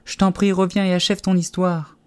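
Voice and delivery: high, pleading call; crying out. A narrator's reading. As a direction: beg someone who is leaving to return. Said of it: "s'écria Alice".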